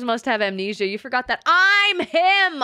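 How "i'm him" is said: drawn-out, higher boast; swaggering and stretched; boastful declaration. Real, not acted. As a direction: proclaim it proudly, stretching both words. Streamer boasting to chat.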